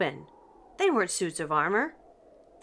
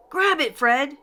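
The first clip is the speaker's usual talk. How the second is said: high, loud urgent shout; 6 semitones higher, 5 dB louder than usual. A narrator's reading. Shaggy telling Fred to grab the sword, a high loud shout. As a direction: shout it across the room. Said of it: "Shaggy shouted".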